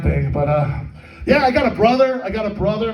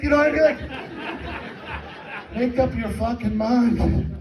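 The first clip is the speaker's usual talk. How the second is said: usual speaking voice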